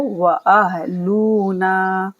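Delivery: slow heavy-voiced हा. Shown as a demonstration. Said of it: "भारी आवाज़ में पढ़ेंगे हा को".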